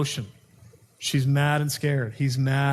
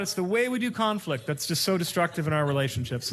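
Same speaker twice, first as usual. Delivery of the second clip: ordinary talk, nothing marked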